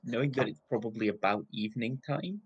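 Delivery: usual speaking voice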